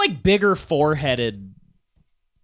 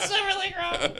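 Silver Lake Ramen squeaked high through laughter; cracking up while repeating the punchline; laughing squeak; 14 semitones higher, 7 dB quieter than usual. Shown as a demonstration. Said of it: "repeats line in high laughing voice".

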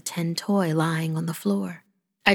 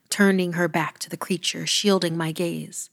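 ordinary delivery

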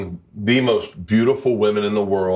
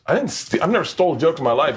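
indignant denial; 7 semitones higher than usual. Retold or imitated. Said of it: "And he goes".

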